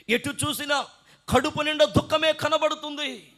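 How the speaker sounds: high, projected complaint; preached lament. Acted. Preacher voices a believer bound by problems, high and forceful.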